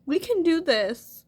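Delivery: crying but insisting we can do this. Real, not acted. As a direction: push the words out through real tears, slowly. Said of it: "I'm always crying".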